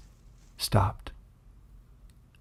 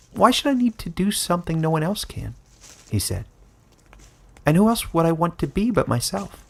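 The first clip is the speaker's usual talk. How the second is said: small creature voice, lightly higher, gently puzzled; puzzled Bando; 6 semitones higher than usual. A narrator's reading. The Bando questions Caleb's wish, puzzled creature voice. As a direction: innocent little animal, honestly wondering.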